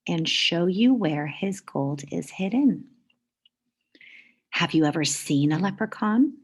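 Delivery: lively storybook read-aloud; reading to children. A narrator's reading. Teacher reading a picture book to the class, lively. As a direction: read it like story time for kids.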